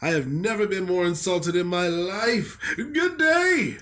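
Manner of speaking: building outrage to a high-pitched "Good day"; hammed-up huffy farewell, rising sharply; pompous indignation. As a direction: storm off as an insulted old critic. Acting an insulted reviewer storming off.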